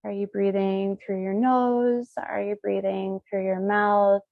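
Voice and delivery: ordinary talk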